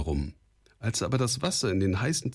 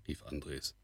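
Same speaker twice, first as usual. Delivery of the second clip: usual speaking voice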